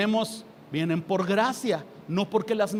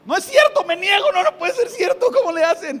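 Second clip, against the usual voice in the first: much higher and louder, a playful shriek of denial; mock outraged disbelief; 9 semitones higher, 8 dB louder than usual. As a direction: refuse it in comic outrage. Mock disbelief at mothers' claim. (no puede ser, exclamado muy agudo y fuerte con incredulidad burlona)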